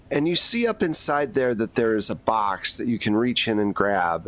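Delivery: ordinary talk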